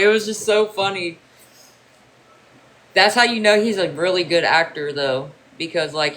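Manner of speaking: normal talking voice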